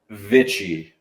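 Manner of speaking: Vichy said as VITCH-ee, starting on a clear V; proper name pronunciation. Shown as a demonstration. Guest teaching hosts his last name, Vichy as VITCH-ee. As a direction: say Vichy as VITCH-ee, falling at the end. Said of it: "properly pronounced is".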